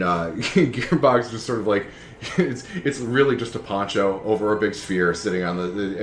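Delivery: normal talking voice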